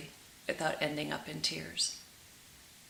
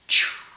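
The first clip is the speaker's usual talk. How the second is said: loud sharp hiss of a struck match; sudden loud match-strike hiss; imitated match strike; 10 dB louder than usual. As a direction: make the flare of a match.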